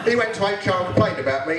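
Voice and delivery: ordinary talk, nothing marked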